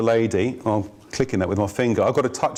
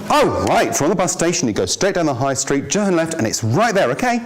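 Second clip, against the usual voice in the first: friendly, helpful directions; 5 semitones higher than usual. Shown as a demonstration. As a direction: give directions like you're glad to help. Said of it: "the first version".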